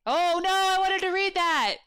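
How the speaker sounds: loud mock-disappointed cry; playful dismay. Real, not acted. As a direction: whine about the spoiler in fun.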